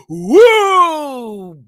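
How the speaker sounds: loud, high sarcastic woo; mock cheer. Mocking the joke with a loud high sarcastic woo.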